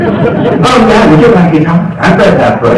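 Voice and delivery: imitating her sarcastic put-down, starting high; mock scornful comeback. Woman mocking the man who asked her to dinner, sarcastic. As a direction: throw his own lines back at him mockingly. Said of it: "just whipped me a new one".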